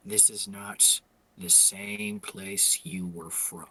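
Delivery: low, soft, very slow delivery; measured in-character warning. Acted. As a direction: warn them quietly, word by word. Game character giving a stern warning.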